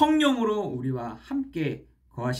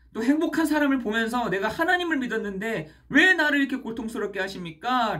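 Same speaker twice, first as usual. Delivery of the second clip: put-on a despairing young man crying out louder, pleading; crying out to God; 7 semitones higher, 4 dB louder than usual. Young man pouring out his pain to God, crying out louder. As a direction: cry out to God in anguish, building in volume. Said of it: "그 청년이 외칩니다".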